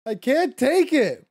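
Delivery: loud despairing groan of defeat; raging gamer. Real, not acted. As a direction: cry out, fed up with failing. Gamer frustrated at repeated failure.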